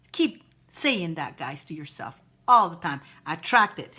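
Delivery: ordinary talk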